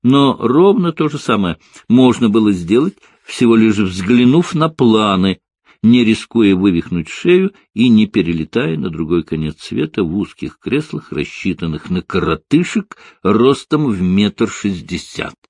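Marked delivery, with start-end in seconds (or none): none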